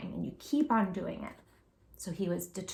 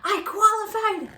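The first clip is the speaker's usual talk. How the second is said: high, loud joyful yell; overjoyed cry of victory; 11 semitones higher, 6 dB louder than usual. Acted. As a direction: shout it out to the family. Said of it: "Andres yelled".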